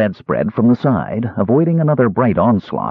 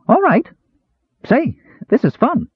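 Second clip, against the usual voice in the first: much higher, delighted kender voice; eager kender. Acted. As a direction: chirp happily from under the pillowcase. Kender playing pillowcase game.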